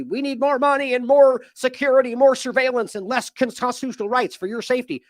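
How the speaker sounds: hammed-up government whine; mock official. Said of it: "So the".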